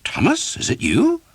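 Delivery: very high startled recognition; see your friend hurt; surprised. Acted. Priest recognizing the beaten archer, shocked.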